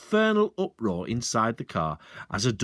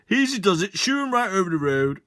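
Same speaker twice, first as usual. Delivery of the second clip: higher, louder herding call; 8 semitones higher, 4 dB louder than usual. Acted. Sergeant calling to the pheasants, higher and louder. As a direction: coax the birds along.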